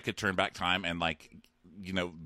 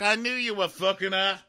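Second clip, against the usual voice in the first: high loud crude jeer; mock leering Bronn; 12 semitones higher, 5 dB louder than usual. Bronn jeering at Tyrion on arrival. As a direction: sneer it like a lout.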